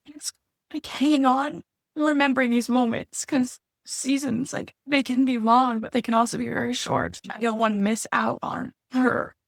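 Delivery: fighting back tears as she talks; real tearful strain rising high; near tears. Real, not acted. Emotional confession about fear, therapy.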